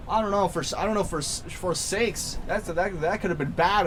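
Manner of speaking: normal talking voice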